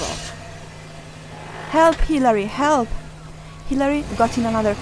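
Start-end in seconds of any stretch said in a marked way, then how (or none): 1.7-2.9 loud, high cry; calling for help. A narrator's reading. Mom being carried off calls Hilary, loud and high. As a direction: yell for your daughter.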